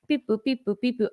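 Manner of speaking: high sing-song beep-boop noises; imitated computer beeps. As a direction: mimic keys being tapped on a computer. Imitating clerk's computer typing.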